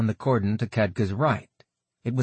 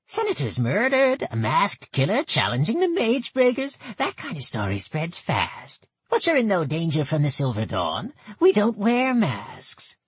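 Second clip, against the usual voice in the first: put-on high, nasal old goblin woman; thick nasal goblin voice; 10 semitones higher than usual. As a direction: pinch it through the nose, goblin. Gertel speaks.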